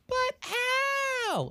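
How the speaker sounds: high wailing "but how"; desperate high cry. Retold or imitated. Orphans cry out in retold scene.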